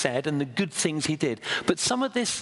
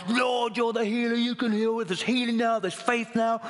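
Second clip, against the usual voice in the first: higher, booming faith-healer call; loud showman preacher; 7 semitones higher than usual. Retold or imitated. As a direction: play the showy healer calling down power. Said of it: "great loud voice".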